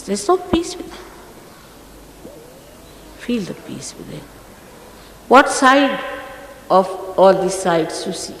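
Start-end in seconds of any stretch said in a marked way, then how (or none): 3.2-4.2 very soft and lower; hushed urging. Real, not acted. Teacher guiding seekers to the peace within, hushed. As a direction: say it low, as a gentle instruction.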